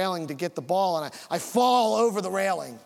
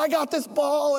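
put-on high, eager boyish answer; excited retold reply; 7 semitones higher, 4 dB louder than usual. Boy answering dad who found him, excited high reply. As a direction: blurt it out in a raised, eager voice.

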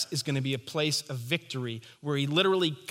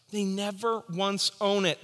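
usual speaking voice

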